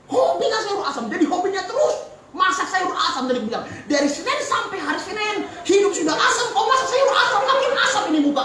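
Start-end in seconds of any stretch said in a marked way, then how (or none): 3.9-8.4 mock complaint to wife. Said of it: "aku bilang".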